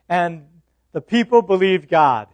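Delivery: usual speaking voice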